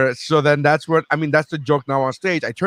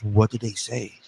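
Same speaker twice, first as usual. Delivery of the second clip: soft doctor's bedside question; a hint of concerned bedside manner; caring doctor act; 2 semitones lower, 5 dB quieter than usual. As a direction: lightly imitate a doctor who seems to care. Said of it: "this is his doctor thing".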